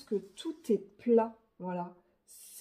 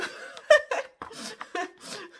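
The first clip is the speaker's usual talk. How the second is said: high, loud laughing fit; amused laughter; 12 semitones higher, 5 dB louder than usual. Real, not acted. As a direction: burst out laughing at yourself. Laughs at her own speech problem.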